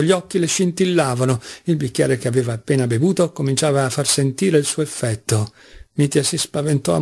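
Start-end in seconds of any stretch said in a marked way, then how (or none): none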